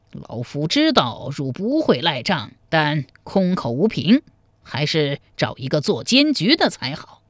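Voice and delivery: put-on old player's measured, tactful insistence; cautious old man. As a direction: voice an old man calmly asking for a referee. Narrator voices the old chess player. (老者腔，从容稳重)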